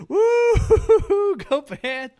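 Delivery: high loud fan whoop; cheering whoop. Pretend. Mock cheering at Panthers game.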